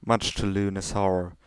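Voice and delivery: ordinary delivery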